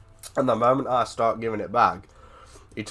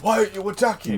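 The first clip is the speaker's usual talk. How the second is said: angry shout; 10 semitones higher than usual. Retold or imitated. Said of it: "he was like".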